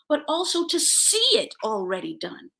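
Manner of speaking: ordinary delivery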